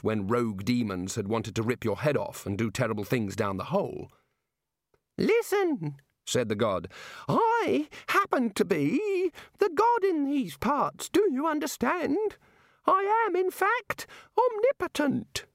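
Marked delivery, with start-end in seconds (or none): 5.2-15.5 very high, slow self-important voice; high pompous deity asserting himself; indignant god. A narrator's reading. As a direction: insist on your power, squeaking.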